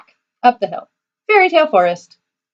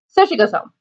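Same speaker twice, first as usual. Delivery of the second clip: ordinary delivery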